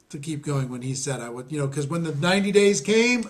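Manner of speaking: usual speaking voice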